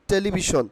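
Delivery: "টেলিভিশন" said te-li-bi-shon, plain t and b; the wrong "টেলিভিশন", unpuffed t, "sh" in the end; common mispronunciation. Shown as a demonstration. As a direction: say "টেলিভিশন" quickly joined as telibishon.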